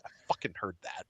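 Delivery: soft, quick, low rebuke; low aside. Acted. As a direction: mutter it fast under your breath. Kale turns round to confront Bara.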